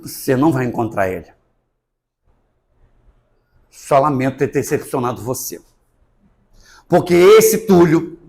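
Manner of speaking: normal talking voice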